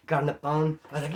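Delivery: normal talking voice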